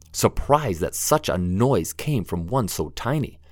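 plain reading voice

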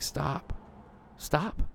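soft stop. Retold or imitated. The Lord gently halting a frazzled speaker, soft. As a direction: hush an overeager child.

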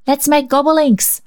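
put-on high, eager child voice; a bright idea blurted out; resourceful little girl. A narrator's reading. Rhonda proposing a game to Cecil, eager high child voice. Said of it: "she suggested resourcefully".

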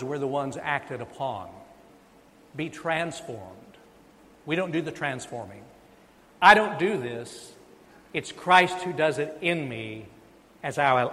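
ordinary talk, nothing marked

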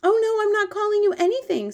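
very high, loud, anxious protest; urgent high-pitched girl's plea; pleading cry. Acted. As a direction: cry out to take back the offence. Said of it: "Sophie cried".

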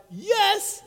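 yes jumping far up in pitch; eager yes. Retold or imitated. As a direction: chirp it like the keen good son. Eager son answering father.

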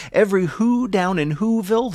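plain reading voice

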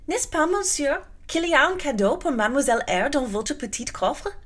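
put-on high, eager child asking in French; eager French girl. Adele begging Rochester for a gift, high eager French. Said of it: "cried out".

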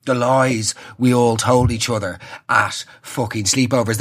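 ordinary talk, nothing marked

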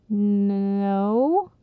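drawn-out, hesitant answer; hesitant, rising at the end; stammering. A narrator's reading. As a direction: stretch "No" out uncertainly. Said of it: "Jake stammered".

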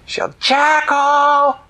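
drawn-out summoning shout; long call. Acted. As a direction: drag the name out calling. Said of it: "calling the jackal again".

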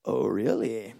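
calm, knowing reply to a gloating rival; unimpressed God. Retold or imitated. God answering Satan's boasting, low and deadpan. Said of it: "And then God goes".

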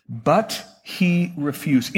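"But he refused" drawn out slowly, low; slow dramatic repeat. Acted. Pastor slowly repeating the refusal. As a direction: stretch every word.

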